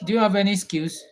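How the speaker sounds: ordinary talk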